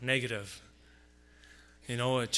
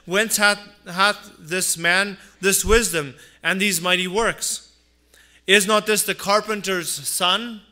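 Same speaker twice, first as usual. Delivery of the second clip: louder, rising pulpit voice; fervent preaching; 3 semitones higher, 5 dB louder than usual. Acted. Pastor preaching to his congregation about Jesus' family, fervent. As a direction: drive the point home from the pulpit.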